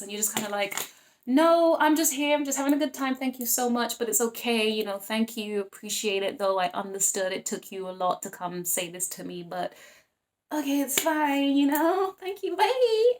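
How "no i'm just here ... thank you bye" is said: high, bright polite rejection of a suitor; sweetly fob off a guy asking to dance; acting out a higher, louder polite no thanks; polite brush-off.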